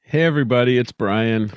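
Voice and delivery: plain reading voice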